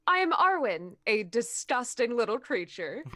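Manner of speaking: ordinary delivery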